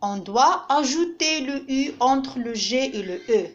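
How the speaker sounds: ordinary delivery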